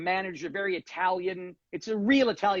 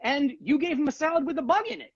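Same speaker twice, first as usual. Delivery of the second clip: higher, lecturing the manager; retold indignation; 7 semitones higher than usual. [name] scolding the manager over the bug, indignant. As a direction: scold him point by point. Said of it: "I go".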